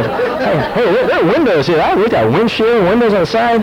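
high, laughing protest; laughing mock alarm. The pastor mimics a nose-picker spotting the windows, laughing. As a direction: point out the windows through a laugh.

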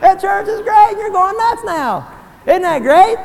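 loud, high gleeful ribbing; loud, high mock-gloating joy; playful teasing. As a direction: tease them with delight. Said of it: "I'm gonna come over to you and say".

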